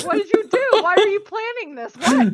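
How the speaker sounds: high, alarmed questions; confused alarm. Retold or imitated. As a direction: retell the panicked confusion. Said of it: "I was like".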